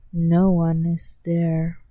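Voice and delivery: ordinary delivery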